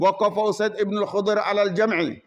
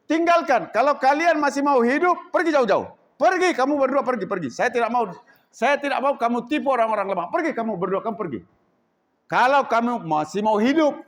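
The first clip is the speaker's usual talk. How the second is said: loud, much higher threatening commands; angry threat; 4 semitones higher, 4 dB louder than usual. Acted. Chief threatening the two strangers, angry shout. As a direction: threaten them to leave now.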